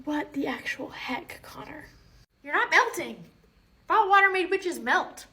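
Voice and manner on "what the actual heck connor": stunned whisper. Acted. Teacher startled by student.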